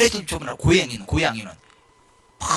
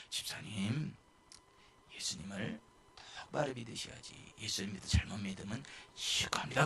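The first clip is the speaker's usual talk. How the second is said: much softer, gently pleading with the deacon; soft, cautious warning to a friend; careful, hushed; 8 semitones lower, 16 dB quieter than usual. Retold or imitated. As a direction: tiptoe through it, barely above a murmur. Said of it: "조심스럽게 얘기를 했거든요".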